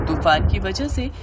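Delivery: ordinary delivery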